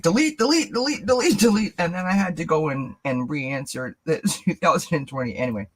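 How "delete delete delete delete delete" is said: high, frantic rapid-fire repetition; mock panic. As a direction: act out frantic deleting, pitched way up. Acting out her frantic deleting.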